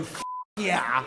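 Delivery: a hint of an eleven-year-old boy; lightly voiced eleven-year-old; kid's voice. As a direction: mumble it like a kid. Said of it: "eleven-year-old me talking to me going".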